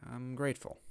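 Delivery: plain reading voice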